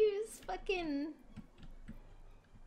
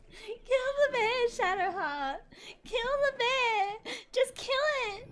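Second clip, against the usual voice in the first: slow, high, louder pleading orders; begging the AI teammate to finally attack; desperate urging; 5 semitones higher, 3 dB louder, slower than usual. Real, not acted. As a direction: drag out each plea to kill the bear.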